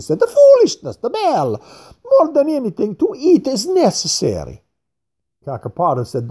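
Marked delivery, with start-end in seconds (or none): 0.2-4.6 scornful fat man. A narrator's reading. Craxy scoffs at the bell.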